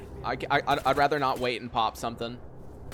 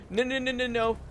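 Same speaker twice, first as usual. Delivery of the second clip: high panicked repeated no; panicked no's; 9 semitones higher than usual. Real, not acted. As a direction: panic rising in pitch. Panicked at wrong click.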